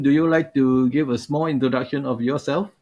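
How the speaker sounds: ordinary talk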